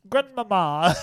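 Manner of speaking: loud, high cartoon Italian cry; ad impression. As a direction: call out like a puppet in an Italian ad. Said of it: "that Domio ad".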